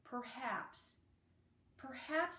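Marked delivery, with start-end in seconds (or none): none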